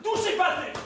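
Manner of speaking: high, loud command to stop; sharp loud order. Acted. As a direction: snap it out to stop him. Stops him touching the phone. (ordre sec et fort pour l'arrêter)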